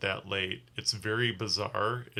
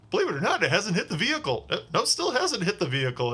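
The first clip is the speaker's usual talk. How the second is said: much higher mock commentator voice; amused passenger; 9 semitones higher, faster than usual. Passenger giggling about the vomit missing the car, mock commentary. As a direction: report it like a gleeful play-by-play. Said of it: "the guy in the passenger seat's like".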